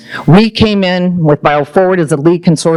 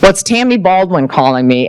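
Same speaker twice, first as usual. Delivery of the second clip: ordinary talk, nothing marked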